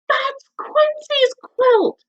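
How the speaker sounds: high, slow duck call. A narrator's reading. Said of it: "quacks Dottie".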